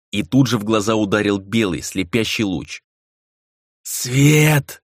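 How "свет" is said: high-pitched cry of protest; pained shout. A narrator's reading. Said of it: "крикнул он".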